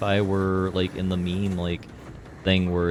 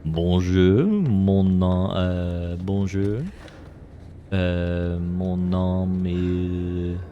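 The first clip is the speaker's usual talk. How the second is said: attempted French, drawn-out vowels; French accent; much slower than usual. Shown as a demonstration. Streamer showing chat his little bit of French, French accent. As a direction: stretch it out in shaky schoolboy French. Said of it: "do a little bit of French".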